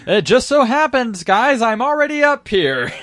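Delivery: halting, dramatic starship captain; high, dramatic captain voice; William Shatner impression. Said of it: "like William Shatner".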